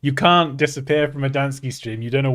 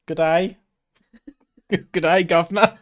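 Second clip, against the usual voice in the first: raised, badly faked Australian greeting; Australian accent attempt; 6 semitones higher, 6 dB louder than usual. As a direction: try Australian and laugh at yourself. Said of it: "I can't do Australian".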